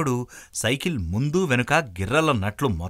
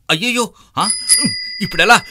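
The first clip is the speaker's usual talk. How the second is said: high, alarmed outburst as the bike wobbles; a rider losing control, crying out in panic; sudden scared yelp, then a worried question; panicked cry; 8 semitones higher, 7 dB louder than usual. Acted.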